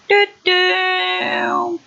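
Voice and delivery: high, drawn-out doo-doo; high-pitched sung game noise; vocal sound effect. Shown as a demonstration. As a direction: sing out a cartoony moving-saw noise. Imitates a game saw's movement.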